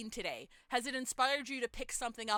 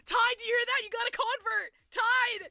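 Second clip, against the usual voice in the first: excited shout; 10 semitones higher, 8 dB louder than usual. Real, not acted. Excited about new convert.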